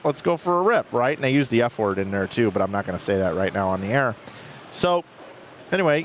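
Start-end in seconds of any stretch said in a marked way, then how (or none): 0.0-0.9 higher, keen yes; mock eager reply. Friend eagerly agreeing to the rip, higher. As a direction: jump at the offer. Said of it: "And, and they're like".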